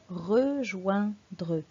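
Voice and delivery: "rejoindre" drawn out slowly as re, join, dre; slow, syllable by syllable. Shown as a demonstration. Teacher asking a pupil to count syllables, "rejoindre" slowly split re-join-dre. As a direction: stretch "rejoindre" into three separate syllables. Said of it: "Compte les syllabes du mot".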